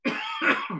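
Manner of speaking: sharp choking cough; coughing up water. Real, not acted. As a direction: cough as water goes down wrong. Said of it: "water went down the wrong tube".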